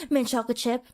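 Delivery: "Mint chocolate chip" in one short go; quick, simple order. Acted. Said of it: "you only said".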